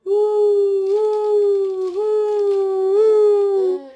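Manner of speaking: wind sound. Shown as a demonstration. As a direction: howl like the snowstorm wind. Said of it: "Can you make those sounds?".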